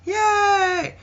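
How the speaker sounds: very high, drawn-out squeal; mock crowd cheer. Crowd answering the host, high mock cheer. Mimics crowd reacting to the names.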